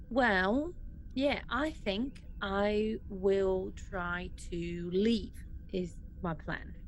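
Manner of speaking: usual speaking voice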